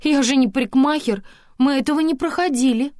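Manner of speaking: high, loud, defensive protest; frightened protest. A narrator's reading. Antonina disclaiming hairdressing skills, alarmed. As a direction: protest in alarm, voice shot upward. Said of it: "испугалась Антонина".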